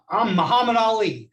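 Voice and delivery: booming self-introduction, no hesitation; loud and direct. Retold or imitated. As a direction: announce your name like a heavyweight champ, square and loud. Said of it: "it was very loud and direct".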